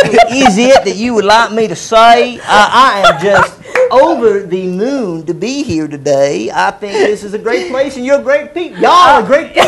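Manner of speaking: hammed-up Texas accent, amused. Shown as a demonstration. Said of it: "Can you do your Texas accent".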